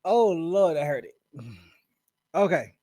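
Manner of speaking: ordinary talk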